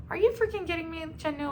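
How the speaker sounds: high-pitched, exasperated self-talk; mock self-scolding.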